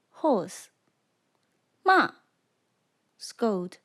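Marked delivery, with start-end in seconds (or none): 1.8-2.1 "Ma" falling sharply from high; fourth tone. Shown as a demonstration. Teacher leads repeat-after-me drill, "Ma" falling sharply. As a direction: drop the pitch steeply on "Ma". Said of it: "using the five tones".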